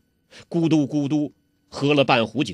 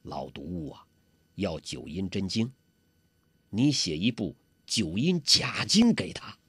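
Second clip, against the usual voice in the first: much softer, conspiratorial murmur in the ear; whispered scheme; 7 semitones lower, 9 dB quieter than usual. Acted. 洪七公凑到郭靖耳边轻轻出主意. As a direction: lean in and murmur the plan. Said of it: "凑到郭靖耳边轻轻地说".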